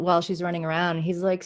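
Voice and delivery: normal talking voice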